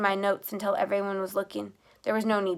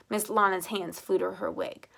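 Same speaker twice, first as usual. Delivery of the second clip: plain reading voice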